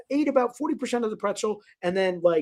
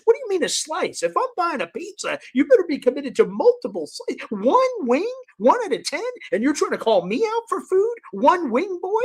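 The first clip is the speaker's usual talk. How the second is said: high-pitched, incredulous scolding; indignant rant; 6 semitones higher than usual. Retold or imitated. Berating friend over one wing of ten, incredulous. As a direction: hammer the questions with comic outrage. Ranting at friend about commitment.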